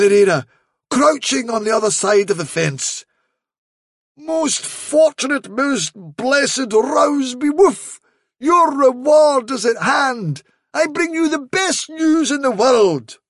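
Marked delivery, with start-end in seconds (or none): 4.2-13.2 high, slow ceremonial proclamation; grand fairy messenger, flattering. Acted. Rabbit posing as herald to flatter a guard dog, grandly proclaiming. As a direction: stretch each phrase like a mystic herald.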